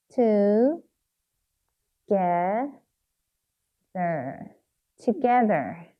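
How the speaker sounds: "Together" with long gaps between syllables; sounding out while writing. Shown as a demonstration. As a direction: spell out "Together" by ear, part by part. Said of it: "한 번 더 써볼게요".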